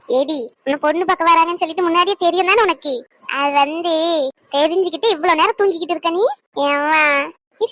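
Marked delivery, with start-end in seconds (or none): none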